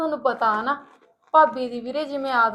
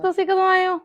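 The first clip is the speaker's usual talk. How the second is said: high, taken-aback question; startled surprise; 6 semitones higher than usual. Acted. Wife asks husband when he arrived, startled. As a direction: ask it high, caught off guard.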